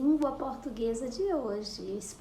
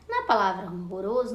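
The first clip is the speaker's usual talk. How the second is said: ordinary talk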